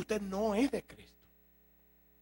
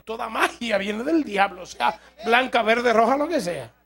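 louder and louder, pounding each colour; forceful preaching; 3 semitones higher, 10 dB louder than usual. Real, not acted. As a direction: hammer home that all magic is evil. Preacher denouncing all magic. (predicando con fuerza creciente)

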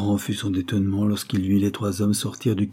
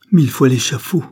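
high, loud outburst of panic; cri de terreur; 4 semitones higher, 9 dB louder than usual. A narrator's reading. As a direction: cry out like a terrified bandit. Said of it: "s'écria le bandit affolé de terreur". (cri affolé de terreur)

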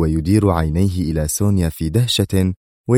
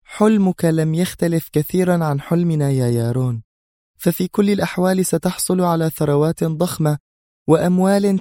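much higher female voice, cold and stern; stern Sonia; 11 semitones higher than usual. Acted. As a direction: play Sonia as a firm woman giving a cold verdict. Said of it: "قائلة في صرامة".

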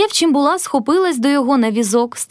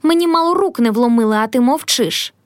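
ordinary delivery, nothing marked

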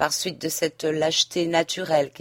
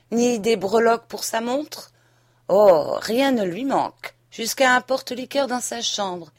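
higher, indignant reproach; reproche indigné; 6 semitones higher, 4 dB louder than usual. A narrator's reading. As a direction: throw each luxury in their face, voice raised. Suite des reproches indignés. (indignation mordante, voix haussée)

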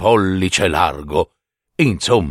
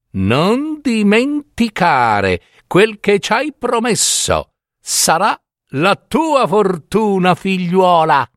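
higher-pitched storybook spinner women; filatrici che ammoniscono; 6 semitones higher than usual. A narrator's reading. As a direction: give a parting reminder in a raised character voice. (voce acuta delle filatrici, come un monito)

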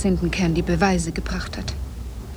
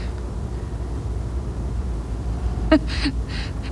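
breathless, high bitter laugh; 7 semitones higher, 5 dB quieter than usual. Acted. Betrayed wife laughs at husband's lie, bitter breathy laugh. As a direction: laugh in disbelief.

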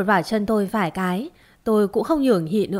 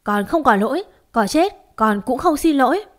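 each word pressed out firmly; rising defiant insistence; 4 semitones higher than usual. A narrator's reading. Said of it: "gằn từng tiếng".

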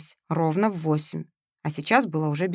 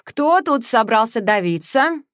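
slow, loud, different man's voice; lazy amused drawl from the doorway; Matveev's voice; 3 semitones higher, 4 dB louder, slower than usual. A narrator's reading. (Матвеев на пороге, медленно и громко)

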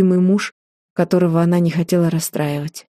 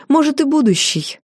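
heated retort; 8 semitones higher than usual. A narrator's reading. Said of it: "в запале".

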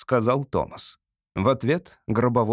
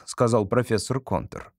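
ordinary delivery, nothing marked

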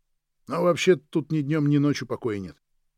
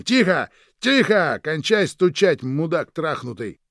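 loud, high yell at the banging; angry shout; 4 semitones higher, 6 dB louder, slower than usual. A narrator's reading. Франсуа stamps and yells at knocking under the floor, angry shout. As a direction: yell it out, drawing out the curse. Франсуа топает ногами и кричит.

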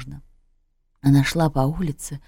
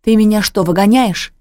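loud, higher indignant cry; shouting; 5 semitones higher, 8 dB louder than usual. A narrator's reading. Mother at daughter's door, shouting that she's being thrown out. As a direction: shout it in disbelief. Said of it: "закричала она".

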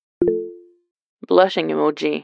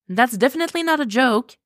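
calling after; 7 semitones higher than usual. Acted. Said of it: "Bart called after him".

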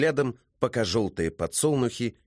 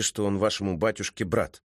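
ordinary delivery

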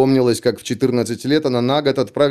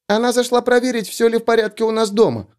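put-on high squeaky four-year-old girl; squeaky little girl voice; 9 semitones higher than usual. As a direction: pipe it up like a tiny girl. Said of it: "писклявым голоском четырёхлетней девочки".

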